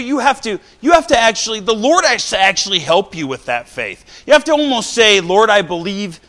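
rising sermon fervour; fervent preaching. Acted. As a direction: preach it out to the pews. Preaching to congregation.

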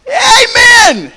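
high, loud exultant amen; a sudden high, loud cry; shouted amen. Real, not acted. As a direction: shout it to the rafters. Preacher shouts his own amen.

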